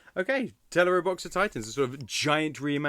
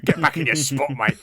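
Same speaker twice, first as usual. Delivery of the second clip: put-on northern accent; 2 semitones lower, 6 dB louder than usual. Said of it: "It's northern guy going".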